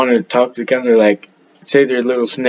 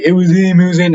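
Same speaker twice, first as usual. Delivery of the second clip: higher, louder pointing-the-finger repeat; mock accusing counselor; 6 semitones higher, 4 dB louder than usual. Counselor blaming the young boy for the clog, loud mock accusation. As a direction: accuse the kid, mimicking her insistence.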